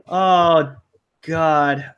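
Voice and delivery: normal talking voice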